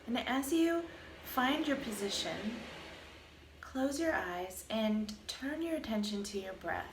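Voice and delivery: calm, even meditation voice with long pauses; guided meditation. Acted. Teacher guiding listeners through a body scan, calm meditation voice. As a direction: lead a body scan, unhurried, leaving space between phrases.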